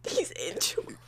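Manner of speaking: unvoiced teasing whisper; a whispered tease to the group. Real, not acted. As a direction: breathe it as a sly aside. Teasing aside about a friend.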